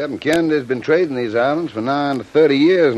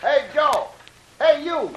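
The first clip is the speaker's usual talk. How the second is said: high-pitched call from below; hailing; 12 semitones higher, slower than usual. Acted. Man in the water to Joe on deck, hailing. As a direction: call out to get his attention.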